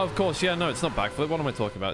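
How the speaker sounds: ordinary talk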